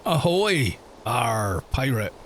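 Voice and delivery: slow, hammed-up pirate growl; a hint of an old sea dog. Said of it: "I don't quite get the pirate accent".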